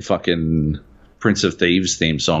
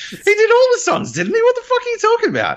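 high, rushed squeal of disbelief through laughter; incredulous outburst; faster than usual. Real, not acted. Arguing with co-host over [name]'s songs, incredulous. Disputing who wrote the songs.

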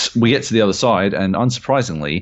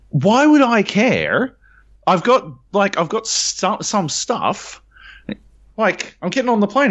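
incredulous protest; 13 semitones higher than usual. Retold or imitated. Retold argument with gate agent.

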